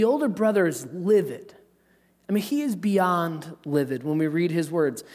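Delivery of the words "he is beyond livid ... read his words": ordinary talk, nothing marked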